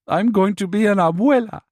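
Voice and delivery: a grandmother-to-be overcome with emotion; choked up. A narrator's reading. Mother learns she'll be a grandmother, choked up with joy. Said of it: "Sylvia got choked up".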